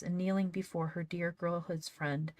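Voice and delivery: ordinary delivery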